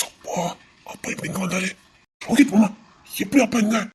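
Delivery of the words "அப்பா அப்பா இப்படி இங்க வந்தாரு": worried aside. Acted. Startled aside about father's arrival.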